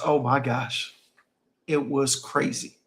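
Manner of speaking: ordinary talk, nothing marked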